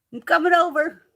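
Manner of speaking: high, loud eager announcement; excited. Real, not acted. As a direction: jump in like you're on your way. Excited about friend's cats.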